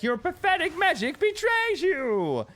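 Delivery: Sindragosa's taunt. Retold or imitated. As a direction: sneer it down at the raid like a dragon boss. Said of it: "she would yell at us".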